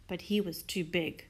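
usual speaking voice

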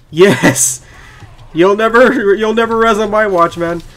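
loud, high-pitched victory cry; triumphant gloating. Real, not acted. Player taunts a fallen enemy, triumphant gloating. Gloats over a kill in game.

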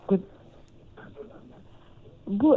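ordinary talk, nothing marked

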